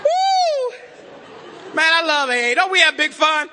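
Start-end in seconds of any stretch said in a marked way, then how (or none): 0.0-0.7 high excited whoop; woo. Real, not acted. Whooping to the AA room after the joke. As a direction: let out a high whoop of delight.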